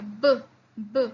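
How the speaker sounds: b said alone with voice from the throat; teacher demonstrating voiced b; voiced sound. Shown as a demonstration. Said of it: "You're saying it from throat".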